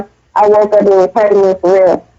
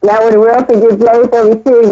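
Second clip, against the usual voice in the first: ordinary talk, nothing marked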